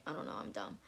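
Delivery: whispered, self-mocking under her breath; whispered aside. Real, not acted. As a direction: drop to a whisper to put yourself down.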